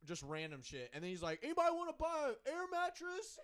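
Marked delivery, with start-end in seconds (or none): 1.4-3.4 high, loud imitation of a peddler; street hawker. Random guy on a bike selling junk, hawked loudly. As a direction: holler a sales pitch from a passing bike.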